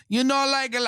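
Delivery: high, put-on Spanish accent; mocking a wannabe Spanish girl's accent; fake Spanish accent. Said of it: "I'm gonna do a Spanish accent here".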